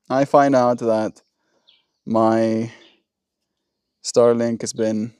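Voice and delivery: ordinary talk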